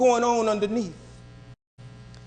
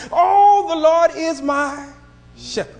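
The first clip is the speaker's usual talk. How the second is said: fervent exclamation; 7 semitones higher, 5 dB louder than usual. Acted. Preacher to congregation, rejoicing in fervent proclamation. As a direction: proclaim the psalm with fervor.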